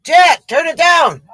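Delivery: high, slow, drawn-out holler; calling out. Real, not acted. Comedian to helper about the oxygen, calling out. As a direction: call across to your helper.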